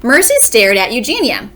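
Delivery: ordinary delivery, nothing marked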